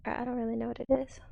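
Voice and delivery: quick whispered aside; whispering. Real, not acted. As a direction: rush it under your breath.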